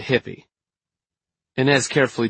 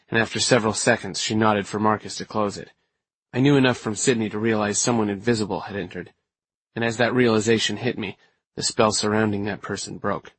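ordinary delivery

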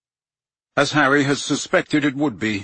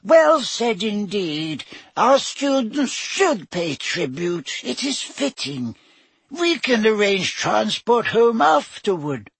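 high squeaked praise; squeaky; 10 semitones higher, slower than usual. A narrator's reading. Flitwick to the staff, squeaking approval. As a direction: squeak it out eagerly.

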